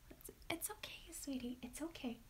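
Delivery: whispered it's okay to a pet; whispered comfort. Real, not acted. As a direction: soothe the anxious dog in a whisper. Whispering comfort to her dog.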